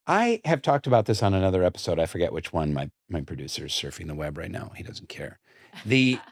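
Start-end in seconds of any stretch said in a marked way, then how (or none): 3.1-5.4 whispered aside. Real, not acted. Host teasing his distracted producer, whispered. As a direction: whisper it as a secret joke.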